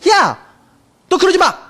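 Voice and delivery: sharp scolding yell; mock angry parent yell. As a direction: snap at the kid like an angry parent. Parents scolding a misbehaving child.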